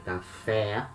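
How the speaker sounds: "café" as "ka-FEH"; stress on last syllable. Shown as a demonstration. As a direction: lean on the final open vowel of "café". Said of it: "mark the strongest syllable".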